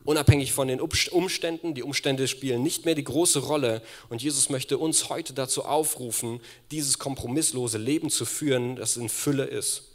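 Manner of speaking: loud fervent preaching; preaching at full force. Real, not acted. Preacher calling the church to live without compromise, fervent. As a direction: proclaim it with conviction.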